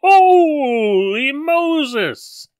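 high, very slow exclaim; amazed exclamation. Real, not acted. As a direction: draw it out in awe. Relief after close call.

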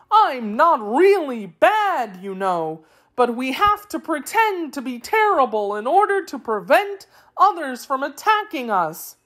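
high, swooping creature voice; pleading Wheeler. A narrator's reading. Wheeler excusing his people to Dorothy, high pleading voice. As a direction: explain yourself in a high, singsong plea.

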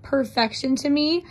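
ordinary talk, nothing marked

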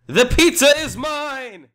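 high, loud cry; hammed-up shout. Customer claims the pizza, high hammed-up shout.

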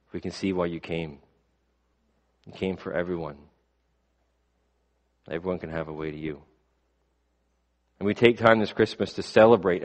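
soft, earnest prayer voice fading to a hush; closing prayer. Acted. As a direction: pray it quietly and sincerely to God.

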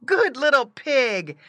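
high, loud, slow praise; delighted praise. A narrator's reading. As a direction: praise a pet in a high, drawn-out voice. Boy praises pig in story.

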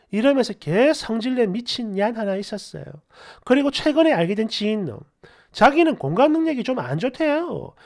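animated story-channel reading; comment read-aloud. Acted. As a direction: read out indignant online replies. Reads listener comments aloud.

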